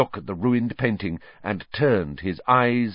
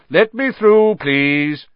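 loud, high, self-important call; pompous Percy; 8 semitones higher, 8 dB louder, slower than usual. A narrator's reading. A head boy pushes through students, pompous and loud. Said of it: "came Percy's voice".

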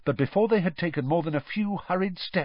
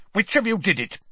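sharp, higher angry demand; snapped; 7 semitones higher, faster than usual. A narrator's reading. Crouch accusing the teenagers, sharp snapping. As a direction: snap the question at them. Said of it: "he snapped".

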